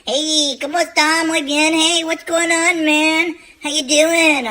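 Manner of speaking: put-on high, slow Mexican-accented cousin. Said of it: "my cousin Hector".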